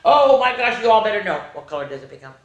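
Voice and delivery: ordinary delivery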